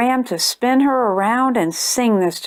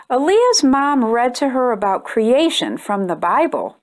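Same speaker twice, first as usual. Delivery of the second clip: ordinary delivery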